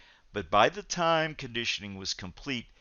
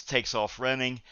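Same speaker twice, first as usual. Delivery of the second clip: ordinary talk, nothing marked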